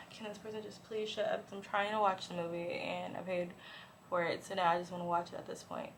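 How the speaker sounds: very soft, muttered grumble; hushed irritation. Retold or imitated. Annoyed moviegoer complaining about a talker, hushed. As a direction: grumble under your breath in a cinema.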